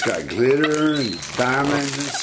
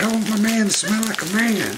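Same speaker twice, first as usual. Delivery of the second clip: high, playful voice for the dog; voicing the dog; 11 semitones higher than usual. Owner voicing the dog's wish in a high voice. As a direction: speak for Mila in a high pretend voice. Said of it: "She's like".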